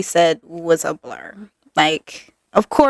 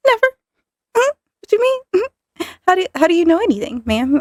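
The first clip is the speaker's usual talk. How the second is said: much higher, squeaky fake-innocent voice, rushing at the end; playing innocent; 12 semitones higher, faster than usual. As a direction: act clueless so mom won't catch on. Playing dumb before doctor, mom.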